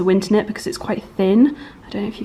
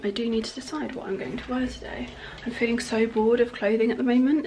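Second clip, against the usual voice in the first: whispering; 2 semitones higher, 7 dB quieter than usual. Real, not acted. Quiet morning chat, whispering.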